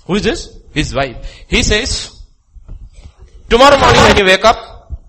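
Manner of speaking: loud, high preaching of scripture; fiery preaching. Real, not acted. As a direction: thunder the verses out. Reading scripture at full force.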